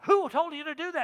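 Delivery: demanding question. Retold or imitated. Leaders demand.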